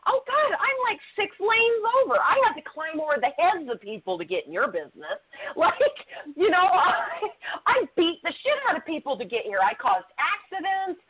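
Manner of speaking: high-pitched, hammed-up exasperation; mock frantic confession. Lane-crosser admitting the struggle to reach someone's business, mock frantic. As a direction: overplay the ordeal, breaking into laughs.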